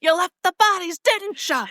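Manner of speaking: high, accusing mock outburst; hammed-up movie intensity. Guy confronting the other in Poltergeist, hammed-up intensity. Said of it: "the intensity of that part of the movie".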